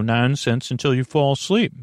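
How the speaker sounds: usual speaking voice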